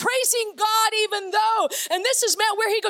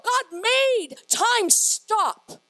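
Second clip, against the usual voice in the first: normal talking voice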